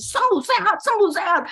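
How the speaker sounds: chanted proclamation of healing; faith declaration. Retold or imitated. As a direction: declare it insistently over a sick child. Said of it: "saya deklarasi".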